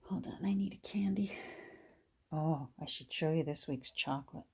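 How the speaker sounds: usual speaking voice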